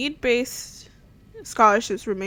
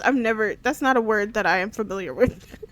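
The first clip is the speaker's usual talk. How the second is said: ordinary talk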